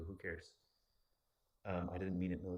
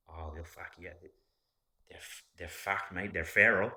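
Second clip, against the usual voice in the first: put-on Australian accent; 7 dB louder than usual. Imitates Australians answering about bikies.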